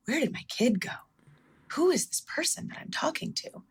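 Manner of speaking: hushed, near-whispered disbelief, pitch lifting; bewildered parent. Retold or imitated. Parent asking about a changed child, breathy bewilderment.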